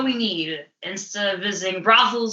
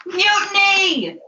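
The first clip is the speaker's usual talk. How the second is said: high, slow, drawn-out yell; drunken accusation; 9 semitones higher, slower than usual. Acted. Drunk sailor accuses his shipmates, drawn-out yell. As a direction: bellow it like a paranoid drunk.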